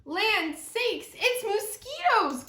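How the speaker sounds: high, loud southern alarm; alarmed wail. Acted. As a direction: aunties shriek at the bugs. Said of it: "cried the Mississippi aunties, wailing with alarm".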